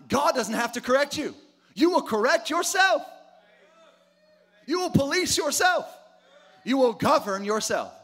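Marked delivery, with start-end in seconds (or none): 1.7-7.9 high, loud climax; rising preaching shout. Real, not acted. Preacher urging the church, building shout.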